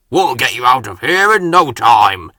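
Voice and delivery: cried out. Acted. Salty loudly reassuring the stranded Murdoch. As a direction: call out confidently. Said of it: "cried Salty".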